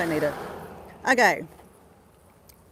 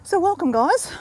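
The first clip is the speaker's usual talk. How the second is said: upbeat welcome; 9 semitones higher, 5 dB louder than usual. Real, not acted. Vlog intro.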